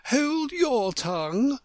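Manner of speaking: high, slow, emphatic order; scolding cry. A narrator's reading. Tiger Lily silencing the Violet, scolding cry. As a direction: cry it out high, drawing out each word. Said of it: "cried the Tiger Lily".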